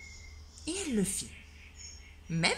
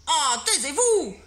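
loud, slow shout; exasperated teacher yelling; 7 semitones higher, 13 dB louder, slower than usual. Acted. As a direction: silence the whole class. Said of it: "cria monsieur Normand".

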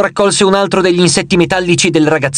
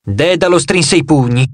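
usual speaking voice

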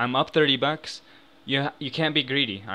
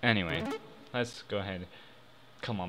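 usual speaking voice

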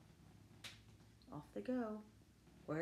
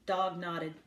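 ordinary delivery, nothing marked

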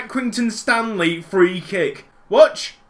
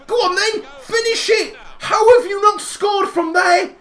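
urging shout; 9 semitones higher than usual. Real, not acted. Gamer urging his striker, high excited shout. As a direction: scream the striker on toward goal.

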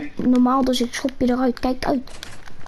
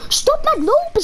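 urgent hiss; 12 semitones higher than usual. Real, not acted. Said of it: "zeg ik".

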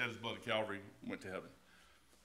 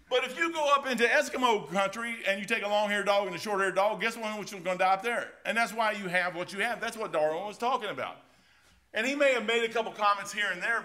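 raised, driving sermon voice; preaching at full force; 6 semitones higher, 5 dB louder than usual. Acted. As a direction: preach it out, push it loud.

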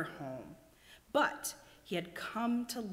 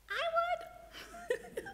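very high, giggly snail. A narrator's reading. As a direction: squeak it out with a giggle. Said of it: "offered one of the snails".

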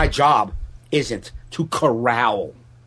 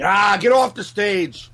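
retold heckle; 7 semitones higher, slower than usual. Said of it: "they were yelling".

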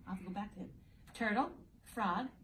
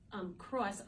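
ordinary delivery

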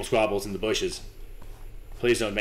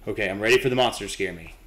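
ordinary talk

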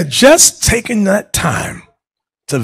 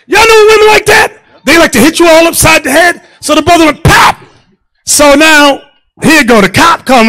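preaching shout; 10 semitones higher, 4 dB louder than usual. Real, not acted. Preaching at full force.

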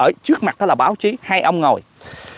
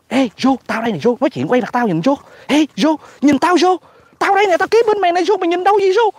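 calling for his attention, pitch climbing high; mock pestering, faster and higher as it builds; Netanyahu; 8 semitones higher than usual. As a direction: imitate an ignored man pleading to be looked at. Voices Netanyahu calling Joe.

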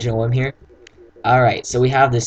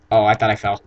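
usual speaking voice

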